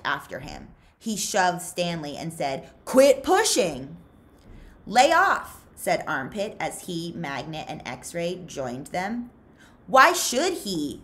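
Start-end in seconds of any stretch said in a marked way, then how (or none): 2.9-4.0 loud, higher, drawn-out order; angry shout. Acted. Zigzag shoving Stanley. 4.9-5.6 loud and much higher, calling over. Acted. Armpit breaking up shoving. 9.9-11.0 loud, slow challenge; snapped. Acted. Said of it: "snapped X-Ray".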